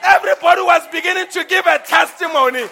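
shouting sermon, loud and high, pressing on; preaching at full force. Real, not acted. Preacher to congregation, acting out paradise, shouting. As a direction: preach it out to the rafters, voice ringing.

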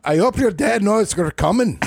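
high-pitched mock British accent; put-on British accent. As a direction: call it out in a silly British voice. Joking arrival at UK listener's.

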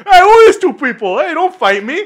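loud, high-pitched mock panic; mock alarm. Rustic guy confronted by bodyguards, hammed-up alarm. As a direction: back off with a laughing, hammed-up protest.

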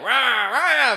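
high gruff game grunts; Wario growl. Retold or imitated. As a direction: bark it like a cartoon villain. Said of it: "Wario would be like".

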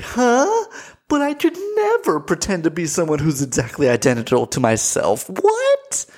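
high-pitched, hammed-up incredulity; mock disbelief. Mocking the twin sister's reply, exaggerated bewilderment. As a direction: end on a squeaked, baffled question.

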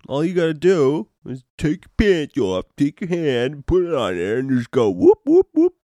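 mock buddy voice building to loud, high whoops; hyped buddy explaining. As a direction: act out the excited friend, ending in a yelp. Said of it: "And he's like".

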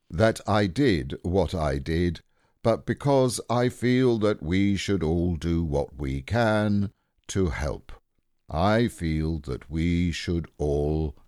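slow, ponderous, self-important oration; gloomy donkey making a pompous speech with weighty pauses; Eeyore speechifying. Acted. Eeyore resumes his speech.

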